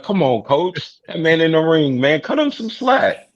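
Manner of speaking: high-pitched plea on someone's behalf; arguing for leniency, voice climbing high; pleading. Real, not acted. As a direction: protest to the coach, pitch way up. Playful pleading with the coach.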